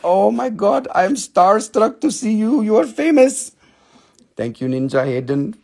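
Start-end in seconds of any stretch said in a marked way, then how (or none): 0.0-3.5 starstruck. Retold or imitated. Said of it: "I was like".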